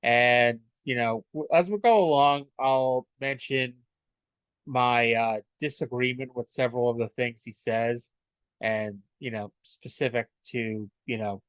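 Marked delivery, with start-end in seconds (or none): none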